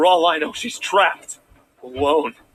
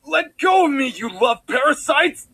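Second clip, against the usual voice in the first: higher, slow furious cry; mild hammed-up rage; struggling shout; 9 semitones higher, slower than usual. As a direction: fight them off as you yell. Prince struggles against guards.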